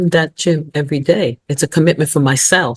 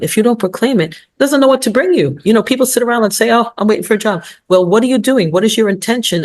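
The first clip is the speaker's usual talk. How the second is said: building excitement; 6 semitones higher than usual. Real, not acted. Preaching, emphatic.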